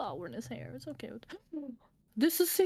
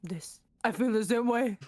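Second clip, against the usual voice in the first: a hint of a tender romantic lead; voiced love line, lightly acted; lightly voiced character. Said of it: "Great voice acting".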